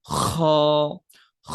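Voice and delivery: "খ" scraped hard, like bringing up phlegm; long throat-cleared "খ"; forceful Arabic "খ". Shown as a demonstration. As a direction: push a rasping kh into a held "খ". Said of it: "একটু ওভাবে জোর দিয়ে".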